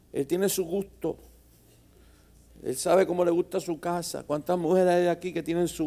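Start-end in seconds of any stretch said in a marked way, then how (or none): none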